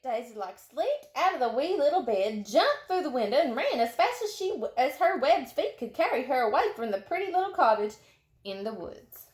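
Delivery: ordinary delivery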